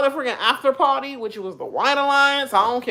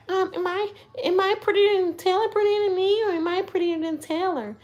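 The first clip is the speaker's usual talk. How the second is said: high, softer voice begging for reassurance; mock an attention-seeker, pitched way up; needy girl; 10 semitones higher, 5 dB quieter than usual. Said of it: "that need attention like that".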